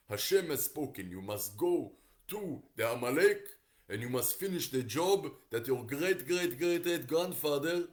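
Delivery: loud, emphatic ancient prophet with put-on accent; hammed-up accented prophet, stressing every command; prophet in accented English. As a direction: deliver a solemn order in a put-on accent. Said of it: "Probably something more like this".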